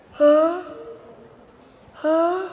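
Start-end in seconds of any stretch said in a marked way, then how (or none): none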